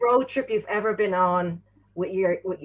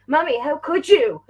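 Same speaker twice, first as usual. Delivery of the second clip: mock betrayed child; 6 semitones higher, 4 dB louder than usual. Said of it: "and he's like".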